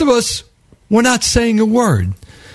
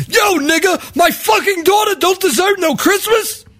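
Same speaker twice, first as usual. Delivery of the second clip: yelling at him; 10 semitones higher than usual. Retold or imitated. Panhandler yelling at a man with a BlackBerry. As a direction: get in his face and yell. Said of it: "he's yelling at him".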